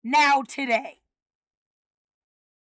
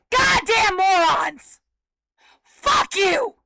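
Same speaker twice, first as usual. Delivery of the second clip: angry shout; 2 semitones higher, 4 dB louder, slower than usual. Real, not acted. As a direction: bite off the insult with fury. Cursing the complicit people.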